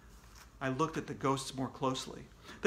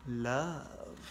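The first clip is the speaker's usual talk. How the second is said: plain reading voice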